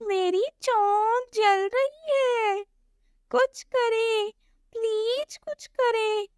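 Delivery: high, slow, soft whimpering chick; tiny bird voice begging for help; burnt-beak chick. Acted. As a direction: plead like a little bird in pain.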